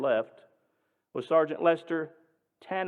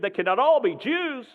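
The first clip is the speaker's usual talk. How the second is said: furious shout, much higher and louder; screamed; 9 semitones higher, 6 dB louder than usual. Retold or imitated. Nazi major screaming at Dad in fury. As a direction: scream it at the sergeant in outrage. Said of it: "he screamed".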